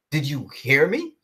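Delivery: ordinary talk, nothing marked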